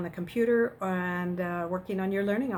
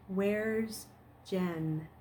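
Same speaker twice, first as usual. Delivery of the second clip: timid; 6 dB quieter than usual. Acted.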